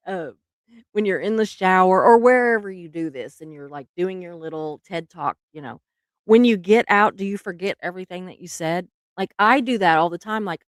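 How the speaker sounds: ordinary delivery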